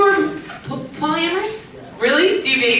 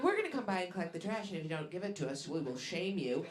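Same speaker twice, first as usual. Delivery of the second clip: imitated flight attendant; 7 semitones lower, 11 dB quieter, faster than usual. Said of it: "you're like".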